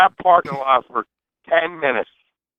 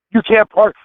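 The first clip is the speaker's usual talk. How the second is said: out of breath; 5 semitones higher than usual. Acted. Winded guard telling the driver not to park, out of breath. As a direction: imitate a winded guard, voice giving out mid-phrase. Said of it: "Why are you so out of breath?".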